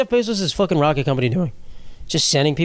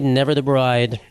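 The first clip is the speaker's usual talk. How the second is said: ordinary talk, nothing marked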